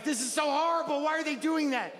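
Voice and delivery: high, plaintive mock wail; hammed-up upset, pitched high; distressed lament. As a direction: whine it in dismay. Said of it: "it was sad".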